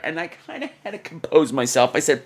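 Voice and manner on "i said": ordinary talk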